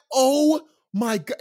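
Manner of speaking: drawn-out high oh my god; stretched amazed exclamation; slow amazed gasp. Retold or imitated. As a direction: savor the shock.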